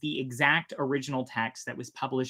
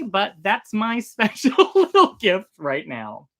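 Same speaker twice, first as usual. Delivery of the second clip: laughing; 7 semitones higher, 9 dB louder, slower than usual. Real, not acted. Laughing at own sewing habit.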